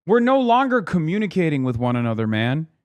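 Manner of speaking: usual speaking voice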